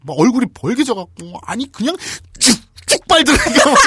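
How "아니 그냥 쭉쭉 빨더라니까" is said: high, loud, worked-up protest; hammed-up eyewitness swearing it's true; overacted insistence. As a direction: push the exaggeration to the limit. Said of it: "뭐, 이렇게까지 오바를 하는데".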